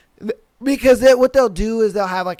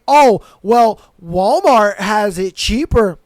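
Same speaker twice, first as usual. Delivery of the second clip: louder, smug customer voice, drawn out; hammed-up customer bragging about Walmart prices; mock smug customer; 6 dB louder, slower than usual. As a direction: mimic a know-it-all shopper's boast. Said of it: "act like saying".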